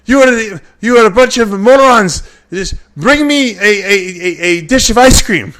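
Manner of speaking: high, loud mock Nasser tantrum; impatient accented bodybuilder yelling; Nasser's outburst. Imitating Nasser scolding the slow restaurant staff, high and loud.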